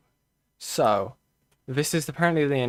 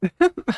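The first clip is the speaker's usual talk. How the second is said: sudden high laugh; bright laugh; 7 dB louder than usual. Real, not acted. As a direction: burst into a quick laugh. Laughing at own apology.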